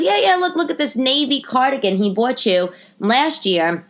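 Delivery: high, insistent pointing-it-out voice; eager saleswoman. Retold or imitated. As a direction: imitate a saleswoman keen to show you. Saleswoman excitedly showing the purchase.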